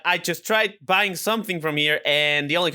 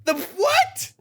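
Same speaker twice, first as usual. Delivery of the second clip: high-pitched disbelief, rising sharply; stunned, squeaky question; incredulous shock; 12 semitones higher than usual. Real, not acted. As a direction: blurt it in astonishment. Shocked by the price just read.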